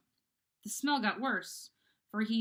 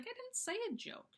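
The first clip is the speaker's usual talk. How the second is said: high, puzzled squeak of denial; a bewildered girl insisting she said nothing; confused protest; 5 dB quieter than usual. Acted. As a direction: protest your innocence, baffled and high.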